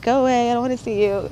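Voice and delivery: high-pitched mock dismissal; retold rejection. Refusing to see the archangel, acted angry rejection. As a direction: playfully re-enact sending someone away.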